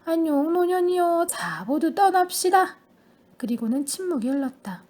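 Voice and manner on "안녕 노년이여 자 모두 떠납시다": higher, louder and slow triumphant cry; exultant call. A narrator's reading. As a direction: proclaim it like a farewell toast. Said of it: "외쳤다".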